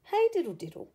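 ordinary delivery, nothing marked

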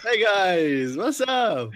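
high, drawn-out holler; calling out a greeting. Pretend. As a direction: sing out a big hello to the group. Calls out a greeting to friends.

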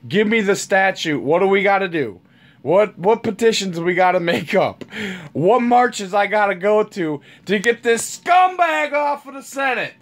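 climbing to a shout at the end; yelling rant. Real, not acted. Demanding ways to remove the senator, building to a shout. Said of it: "I'm yelling again".

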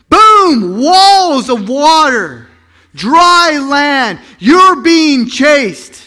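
high, loud and slow; loud dramatic build. Acted. Preacher thundering the sea parting, loud and high. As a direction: boom it out like the sea splitting.

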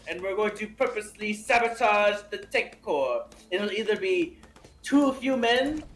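put-on deliberate French-accented explaining. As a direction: explain the absurd plan as a Frenchman. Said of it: "in your French accent".

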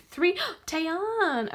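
high, loud gasp into the name; excited gasp. Real, not acted. Revealing a [name] card, gasping high and loud.

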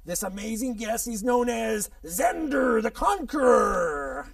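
slow, loud ring-announcer reveal; building showman's flourish; grand guest announcement. Acted. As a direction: stretch the name out grandly.

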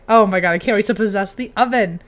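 ordinary talk

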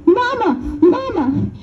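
put-on a kid who can't wait to tell it; excited child. Child running in to tell mama about freedom, excited.

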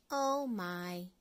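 ordinary delivery, nothing marked

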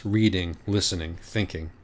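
plain reading voice

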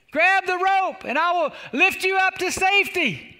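high-pitched acted holler; Charlie shouting down. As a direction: call down from a helicopter. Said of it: "Charlie shouts down and says".

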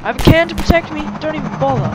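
loud, fast and breathless; being chased. Real, not acted. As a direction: yell it while running for your life. Fleeing soldiers across rooftops.

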